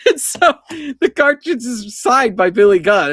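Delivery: laughing high and loud. Real, not acted. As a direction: laugh through the punchline. Laughing at his own story.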